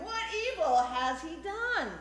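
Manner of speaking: high, loud protesting question; crying out. A narrator's reading. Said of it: "Pilate was crying".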